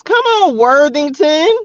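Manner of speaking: very high "Come on, Worthington"; excited call. Real, not acted. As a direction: sing it out high in praise. Excited shout to spirit.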